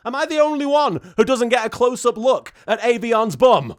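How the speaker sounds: usual speaking voice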